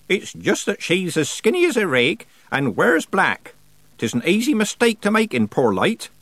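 put-on high-pitched rustic old man; old countryman. A narrator's reading. Old man excuses his shooting to Nanny, defensive.

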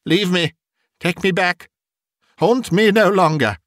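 high-pitched desperate outcry; desperate exclamation. A narrator's reading. Scrooge wrestling with the ghost to be freed, desperate exclaiming.

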